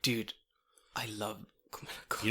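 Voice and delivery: quick, hushed, breath-only confession; adoring whisper. Real, not acted. Podcaster hoping for coffee sponsorship, quick adoring whisper. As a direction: whisper your love for it.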